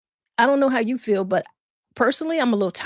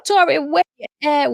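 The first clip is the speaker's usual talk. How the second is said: calling her name loudly; 4 semitones higher, 5 dB louder than usual. Real, not acted. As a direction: call out to her. Calling out to guest.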